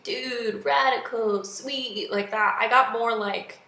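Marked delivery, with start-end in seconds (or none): 0.0-2.1 lightly imitated surfer dude; a hint of laid-back surfer bro. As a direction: toss out each word like a mock surfer. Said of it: "like, a surfer bro".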